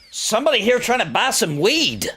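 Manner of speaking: high-pitched relayed holler; calling upstairs. Retold or imitated. Old man alerting someone upstairs about visitor, calling out. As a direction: imitate an old man reporting up the stairs. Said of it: "And then I hear him say".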